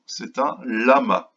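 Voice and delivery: ordinary talk, nothing marked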